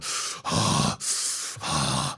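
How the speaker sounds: long, soft breathy sighs; imitated trance breathing; entranced exhale. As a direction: breathe out slowly as if enraptured. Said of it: "they were just going".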